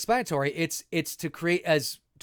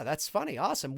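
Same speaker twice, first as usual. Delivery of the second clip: normal talking voice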